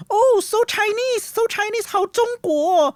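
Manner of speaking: very high, sing-out tourist exclamation; mock excited shout. Retelling classmates' amazement in Beijing, high-pitched mock shout. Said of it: "they shouted".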